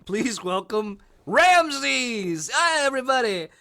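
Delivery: high, loud, excited emcee; hammed-up compere introducing a lion-man; mock show host. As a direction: announce the act like a cheesy compere. Mock ancient comedy show host.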